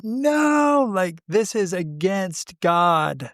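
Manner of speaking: mock outraged congregation, stretching the first word high; indignant crowd protest. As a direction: cry out in scandalized protest, imitating a crowd. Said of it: "and they were like".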